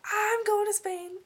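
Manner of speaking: squealing I'm going to Spain to friends in the lounge; excited shout. Retold or imitated. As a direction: burst out the big news to the whole room. Announcing Spain trip to the lounge.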